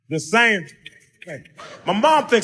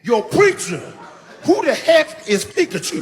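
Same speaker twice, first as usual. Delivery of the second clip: dumb brother; 9 semitones higher, slower than usual. Acted. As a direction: blurt it out like a clueless brother. Imitates his dumb brother's outburst.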